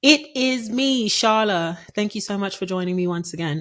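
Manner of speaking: mock British host voice; terrible British accent. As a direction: put on a bad British accent for the podcast welcome. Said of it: "why am I doing a terrible British accent".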